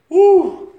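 high joyful shout of woo; preacher whooping; excited whoop. Real, not acted. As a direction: let out a loud whoop. Preacher's exclamation.

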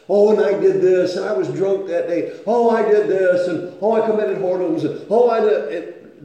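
a hammed-up parade of confessions; mocking confession list. Wife's litany of confessions mocked.

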